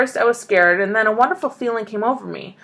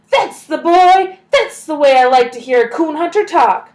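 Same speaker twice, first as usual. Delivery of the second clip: pent-up voice; 7 semitones higher, 7 dB louder than usual. A narrator's reading. Grandpa praising Billy's answer, bursting out. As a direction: let it all burst out. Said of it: "In a pent-up voice".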